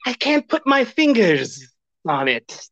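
lightly hissed snake tone. Pretend. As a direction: let the S's linger slyly. Still demonstrating the snake tone.

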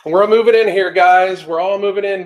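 loud call over the chainsaw noise. Real, not acted. Host moving viewers indoors away from chainsaw, loud call. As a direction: raise the voice to carry over a roaring chainsaw.

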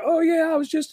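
high-pitched mock drunk kid. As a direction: imitate the eager tipsy probie brushing it off.

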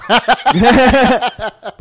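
high burst of real laughter; loud laugh. Real, not acted. Laughing at her own brag about being smart. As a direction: cracks up at herself.

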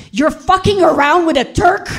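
very high, outraged accusation; screamed accusation. Retold or imitated. Husband accusing his wife, screaming in outrage. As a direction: hurl it out high, in outraged disbelief. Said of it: "he screamed".

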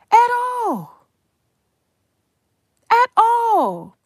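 cracking high. Real, not acted. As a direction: voice jumps way up in disbelief. Exasperated denial, voice cracks high.

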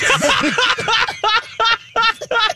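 high, shrieking burst of laughter; big laugh. Real, not acted. Reacting to funny photo text.

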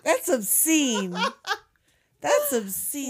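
words through laughter; laughing. Real, not acted. Reacting to the crude joke, laughing protest. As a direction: crack up while protesting.

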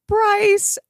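high gasp, then the name; surprised gasp. Real, not acted. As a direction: gasp in delighted shock. Gasps at news about Bryce.

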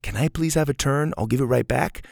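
gentle asking for a turn; polite request. Shown as a demonstration. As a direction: ask nicely, promising to return it. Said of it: "versus somebody that says".

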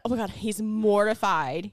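usual speaking voice

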